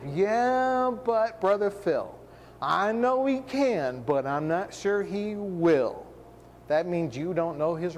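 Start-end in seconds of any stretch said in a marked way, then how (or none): 0.0-6.0 slow mock objection, starting high and sinking; doubting believer. Doubter objecting to Brother Phil, drawn-out mock hesitation. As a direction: drag out the hedging like a hesitant skeptic. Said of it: "here comes the unbelief".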